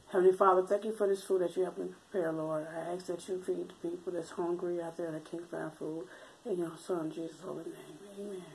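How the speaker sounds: soft, hushed mealtime prayer; praying. Acted. Says grace over breakfast.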